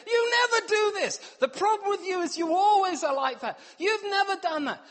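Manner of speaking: high, loud hammed-up wife voice; mock wife accusing. Wife accusing her husband of never helping, high mock voice. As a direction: squeal it like an outraged wife. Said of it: "she would say".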